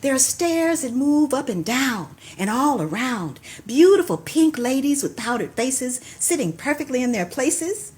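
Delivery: rhythmic poem recital with cues; poetry call and response. Acted. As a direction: perform the poem, leaving room for the response.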